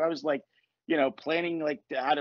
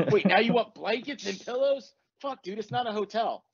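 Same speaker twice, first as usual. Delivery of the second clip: put-on higher, scornful young roommate; mock jerk; 6 semitones higher than usual. Twenty-year-old roommate to a friend moving in, mock asshole. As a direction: brush the guest off with exaggerated disbelief. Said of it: "Anders and Brian were assholes".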